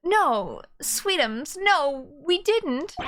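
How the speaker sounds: confess, then reassure a sweetheart in character; confessing game character. Acted. Voices game character confessing, consoling.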